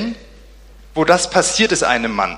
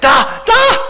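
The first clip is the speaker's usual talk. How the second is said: very high, loud cry of alarm; panicked shout; 6 dB louder than usual. Acted. Disciple spots something on the water, shrieks high and loud. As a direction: shriek and point in terror. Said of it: "ein anderer Jünger schreit".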